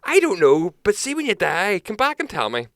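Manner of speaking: high, loud cheeky comeback; cheeky drunk. Retold or imitated. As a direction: fire it back high like a smug drunk mate. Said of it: "your man turns around to him and goes".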